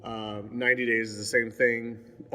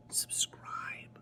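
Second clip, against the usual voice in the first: whispered aside; playful whispered plug; 11 dB quieter than usual. Pretend. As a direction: drop to a sneaky whisper. Outro list, whispered item.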